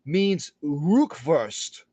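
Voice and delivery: ordinary delivery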